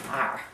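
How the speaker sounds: letter R attempted low and quiet; awkward hard R. Shown as a demonstration. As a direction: try saying R with the r sounded. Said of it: "I can't even say it".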